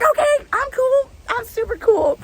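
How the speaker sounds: high, mock-cool squeak; faking calm. Answering her friend's "act cool", in a high squeaky fake-cool voice. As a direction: pretend to be cool and fail.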